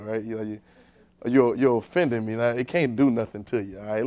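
usual speaking voice

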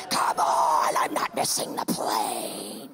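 very low, drawn-out monster roar; hammed-up guttural determination; desperate growl. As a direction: growl it slowly through the strain. Straining across airport, desperate to catch plane.

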